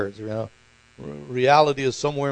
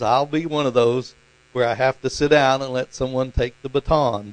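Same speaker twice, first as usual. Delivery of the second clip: usual speaking voice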